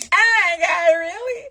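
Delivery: high, loud weepy squeal; mock tearful reaction. Answering a sobbing customer's message, mock tearful squeal. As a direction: imitate being overwhelmed, voice shooting up high.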